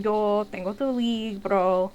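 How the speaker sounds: slow, drawn-out Spanish teacher voice; imitating tall teacher. Teacher holding the textbook, slow Spanish. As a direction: stretch out Yo tengo tu libro like a big cowboy teacher. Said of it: "man in cowboy boots saying".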